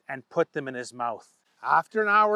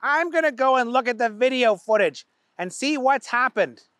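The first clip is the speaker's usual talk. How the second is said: boss voice; 7 semitones higher, 4 dB louder than usual. Acted. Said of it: "The owner of the factory said".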